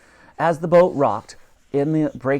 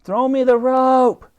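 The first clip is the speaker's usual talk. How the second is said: high, slow call across the ice; high girl's call, stretched and loud; 12 semitones higher, 7 dB louder, slower than usual. A narrator's reading. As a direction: shout to the soldiers far away. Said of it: "called out Elsie".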